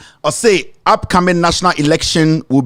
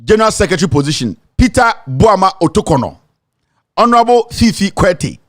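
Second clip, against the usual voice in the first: usual speaking voice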